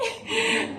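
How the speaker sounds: high laughing sob; laugh-cry. Real, not acted. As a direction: let the laugh tip into a near cry. Laugh after the quoted prayer.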